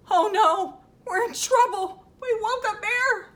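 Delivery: high, loud pretend panic; acted alarm. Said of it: "Maybe they're scared".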